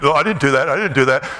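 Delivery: low, innocent denial, lightly voiced; mock denial. Yoga class denying the smell, in a low mock innocent voice. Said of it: "pretending like".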